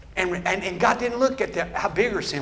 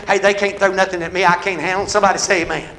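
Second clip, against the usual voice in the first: usual speaking voice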